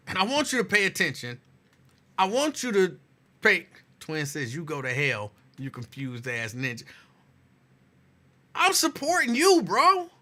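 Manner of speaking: usual speaking voice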